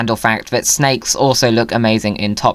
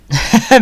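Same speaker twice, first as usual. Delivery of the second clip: laugh; 11 semitones higher, 4 dB louder than usual. Real, not acted. Admiring hatted dinos.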